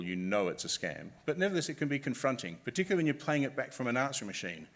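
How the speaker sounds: ordinary delivery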